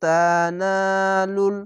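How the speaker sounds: drawn-out recited তানালুল with nasal ghunna held long; Quranic recitation. Shown as a demonstration. Teacher recites the word for students, slow and drawn out. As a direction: chant তানালুল slowly, stretching each vowel. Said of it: "লাং".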